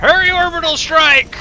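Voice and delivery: frantic high shout; urgent shout. Real, not acted. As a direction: yell it out in a panic.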